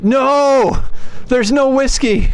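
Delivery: high loud cry of dismay; dismayed shout. Real, not acted. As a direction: wail that it's gone. Game dismay.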